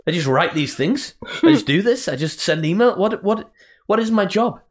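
high, rising panic over her own job; panicked rant. Retold or imitated. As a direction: spiral into comic panic. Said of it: "That's a little complex that I have".

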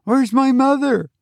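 put-on very high child's voice; demanding boy. A narrator's reading. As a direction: ask it high, like a boy wanting his mother. Boy demands his mother.

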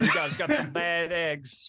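Joe Biden. Acted. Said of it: "I was like Joe Biden".

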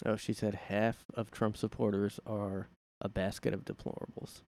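ordinary delivery

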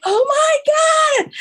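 very high, loud, drawn-out squeal; excited kids. Retold or imitated. Kids running to the new store, loud high squeal. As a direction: shriek with delight like children.

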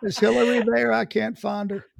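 scratchy ex-president impression; hoarse joking presidential impersonation; Bill Clinton. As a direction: ask in a scratchy, breath-short politician voice. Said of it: "you sound just like him".

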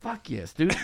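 usual speaking voice

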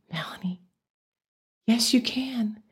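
soft reassuring whisper, barely voiced; God's whisper. God comforting a frightened Melanie on the freeway, soft whisper. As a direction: breathe it gently like a voice from heaven. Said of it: "I heard God's voice say to me audibly".

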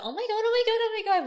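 high, loud gushing oh my Gods; excited squeal. Retold or imitated. Fangirl meeting another true-crime fan, squealing excitedly. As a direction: gush like a fan who found a fellow fan.

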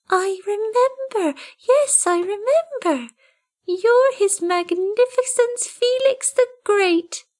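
a kitten waking to a place it knows; newborn kitten voice. A narrator's reading. Tiny kitten, high and slow in wonder.

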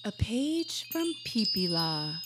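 slow, grand announcement; mock announcer. Host introducing an old shared travel diary, mock announcer. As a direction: present it like a show segment.

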